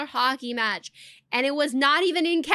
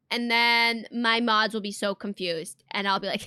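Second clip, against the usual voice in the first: ordinary delivery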